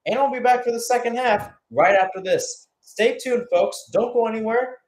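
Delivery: upbeat sign-off. Real, not acted. Sign-off before the break.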